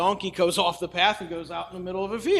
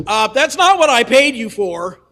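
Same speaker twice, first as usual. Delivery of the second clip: loud, higher complaint from a cheated king; indignant king; 4 semitones higher, 8 dB louder than usual. Retold or imitated. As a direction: protest like a customer who got the wrong order. King complains after the blessing.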